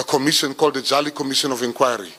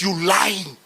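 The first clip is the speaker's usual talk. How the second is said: accusing mother, pitched up; a son retelling his mom scolding him, pitched up; mother's accusation; 5 semitones higher than usual. Retold or imitated. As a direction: play the suspicious mom scolding her son. Mother voiced in story.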